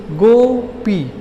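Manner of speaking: "Gopi" very slowly, in two separate beats; split into syllables. Shown as a demonstration. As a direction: stretch both halves of "Gopi" for finger counting. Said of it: "उंगली पर बोलिए".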